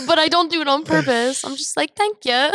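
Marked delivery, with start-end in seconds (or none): 2.0-2.5 put-on higher British accent thank-you; a British accent slipping in at the counter; light British accent. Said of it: "I'll accidentally do a British accent".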